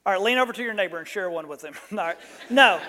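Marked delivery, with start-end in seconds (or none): 2.5-2.8 laughing no. Real, not acted.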